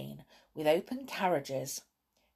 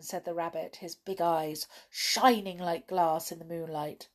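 ordinary delivery

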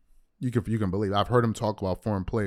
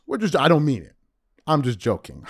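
normal talking voice

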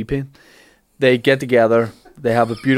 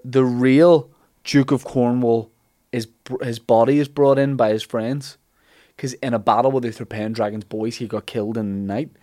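normal talking voice

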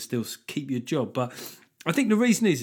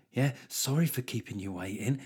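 the same apology said softer, sneering and insincere; snide mock apology; 6 dB quieter than usual. Canteen lady sneering at a waiting customer, snide and quiet. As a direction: let the fake politeness drop into contempt. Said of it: "They're like".

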